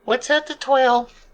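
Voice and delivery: very high, tiny falsetto; squeaky character voice. Acted. Small character asking companions whether to go over, squeaky falsetto. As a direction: pipe up in a high little voice.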